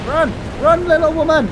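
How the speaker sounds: high, loud yell urging her to run; urgent shout. Real, not acted. Streamer yelling at her fleeing character, urgent shout. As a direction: shout to hurry her along.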